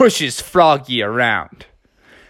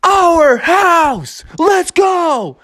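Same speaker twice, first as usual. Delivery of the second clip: team cheer; 13 semitones higher, much slower than usual. Acted. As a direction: shout it as a team. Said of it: "everybody was like".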